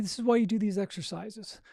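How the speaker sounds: ordinary talk, nothing marked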